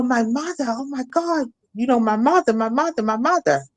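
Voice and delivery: a mild mock scream for his mother; son screaming. Son running back down the street crying for his mother, high anguished. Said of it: "he was just screaming".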